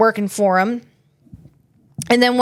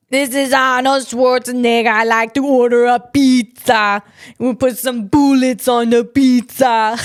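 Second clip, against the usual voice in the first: hammed-up, badly faked Austrian accent; Arnold Schwarzenegger impression. Podcaster imitating Arnold ordering a pizza, mock Austrian accent. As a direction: do a terrible mock-Austrian action star. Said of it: "Let's see if I could do Arnold".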